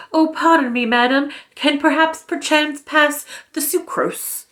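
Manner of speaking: hammed-up stuffy politeness; pompous formal speaker. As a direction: ask it like an affectedly proper guest. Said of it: "it kinda had that feel to it".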